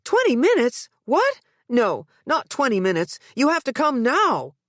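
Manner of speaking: incredulous mother demanding; outraged disbelief. A narrator's reading. Mother to the operator, outraged. Mother told ambulance delay.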